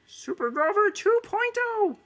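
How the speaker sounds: very high, slow, half-whispered hero; Super Grover voice. Shown as a demonstration. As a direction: announce yourself as a breathy puppet hero. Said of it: "Super Grover is more like".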